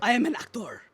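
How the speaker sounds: high hammy fake Italian accent. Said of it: "Why are we doing fake Italian accents?".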